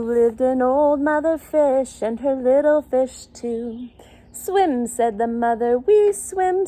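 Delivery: lively sing-song read-aloud with little voices for animals; storybook reading. Acted. Reading a picture book to young children, sing-song storytelling.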